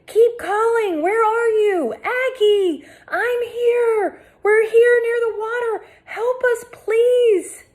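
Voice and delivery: sustained high desperate shouts; shouting for help. A narrator's reading. Girl yelling to rescuers near the water. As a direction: keep yelling so they find you.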